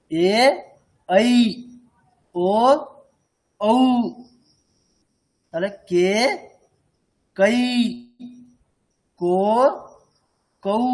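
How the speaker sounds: "ঐ" and "ঔ" with both vowels sounded; isolated, drawn-out vowels and syllables; clearly articulated diphthongs. Shown as a demonstration. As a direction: finish the glide in "কৈ" and "কৌ". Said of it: "এখানে কিন্তু স্পষ্ট করতে হবে".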